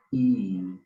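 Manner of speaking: I said as a drawn-out, long ee vowel; long ee demo. Shown as a demonstration. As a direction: stretch the I as a long ee. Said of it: "Pero largo".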